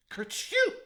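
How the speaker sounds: a loud, very high sneezing sound; loud sneeze. A narrator's reading. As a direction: burst out a sudden sneeze. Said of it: "Peter sneezed".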